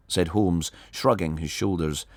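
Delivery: ordinary delivery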